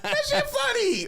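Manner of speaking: very high, slow, wheezing laugh through the words; laughing hard. Real, not acted. Comedian insisting the joke is funny, laughing hard.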